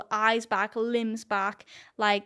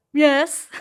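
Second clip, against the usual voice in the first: loud, higher mock-crowd "yes"; eager quoted reply; 5 semitones higher, 10 dB louder than usual. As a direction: imitate followers cheering you on. Quoting followers' eager answer.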